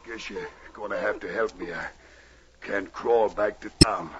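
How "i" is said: usual speaking voice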